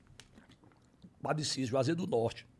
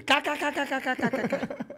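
high staccato mock laugh; put-on machine-gun laughter; imitated cackle; 8 semitones higher, 4 dB louder than usual. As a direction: rattle off a rapid fake cackle. Imitates caller laughing on phone.

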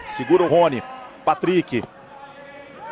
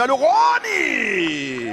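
second call much higher and stretched; explosive goal commentary; 5 semitones higher than usual. Real, not acted. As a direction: call it, then scream it.

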